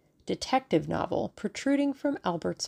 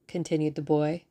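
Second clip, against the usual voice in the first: plain reading voice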